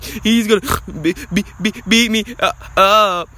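high, loud stammering panic; hammed-up scared stutter; mock panicked whine. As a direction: play him as a terrified kid tattling. Mocking the bully fleeing in panic.

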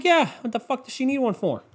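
high, brash retort; mock defiance. Telling friends he skipped the gift, brash high retort. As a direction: toss it back like the obvious answer. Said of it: "And I'm like".

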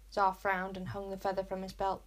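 ordinary delivery, nothing marked

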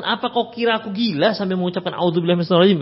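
mock indignant outburst; retold angry refusal. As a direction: imitate an angry man refusing advice in a rush. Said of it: "sahabat yang marah tadi nggak terima".

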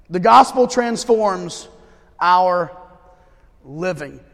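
drawn out, pauses between words; slow spaced emphasis. Acted. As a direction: land the theme one word at a time. Repeats theme slowly.

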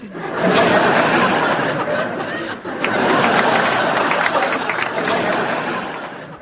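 a big burst of laughter; loud laugh. Real, not acted. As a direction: laugh out hard. Hearing a silly excuse poem.

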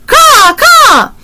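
very high, loud caws; raven cawing. A narrator's reading. Flying raven thanks Annie with high caws. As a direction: imitate a bird calling from the air. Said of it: "he called".

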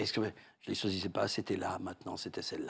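low, quiet, chilling killer voice; a murderer answering calmly, very low and soft; serial killer voice. Retold or imitated. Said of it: "Avec l'accent".

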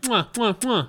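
light kissing sounds; chef's kiss. Shown as a demonstration. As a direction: demonstrate the finger kiss. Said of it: "You know, like that".

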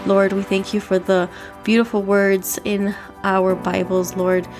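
ordinary delivery

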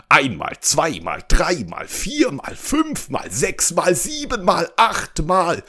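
excited counting. A narrator's reading. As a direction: count up excitedly, pitch climbing high. Princess counts juggles toward record. (begeistert hochzählen, immer höher)

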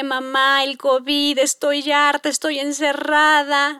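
drawn-out, whiny mock crying; imitated crying child. Daughter tells mom she misses school, imitated tearful whine. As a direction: wail it slowly like a tearful little girl. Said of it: "hubieran visto el llanto".

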